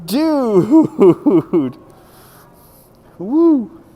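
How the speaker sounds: high, drawn-out whoops with laughter; awed whooping. Real, not acted. Spectator cheering a performer doing it one-handed, high laughing whoops. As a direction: let amazement spill out in long high cries.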